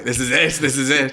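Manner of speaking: high, loud excited cry; excited realisation. Retold or imitated. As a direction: exclaim it like a sudden eureka. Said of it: "but I was like".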